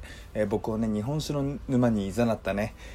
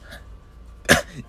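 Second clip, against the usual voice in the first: coughing fit. Real, not acted. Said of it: "肺と息が変な方向になっちゃいました".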